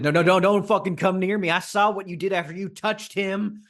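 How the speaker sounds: high, fast, frightened yell; panicked shout. Acted. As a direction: scream at him to stay back, terrified. Panicked gunman warns him off.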